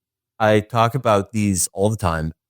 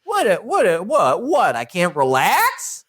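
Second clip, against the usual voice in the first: indignant David; 6 semitones higher than usual. Retold or imitated. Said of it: "And David's like".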